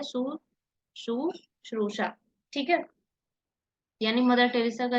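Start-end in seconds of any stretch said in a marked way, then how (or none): 1.0-2.2 शुश्रूषा said slowly and softly, syllable by syllable; careful slow model. Shown as a demonstration. Teacher modelling the correct word for students. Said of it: "होता क्या है?".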